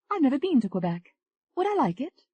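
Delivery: Tony, flirty.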